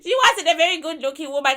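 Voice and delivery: fast, laughing through the words. Real, not acted. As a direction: giggle out the insult. Laughing while insulting the spirit.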